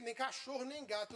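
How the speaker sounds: ordinary talk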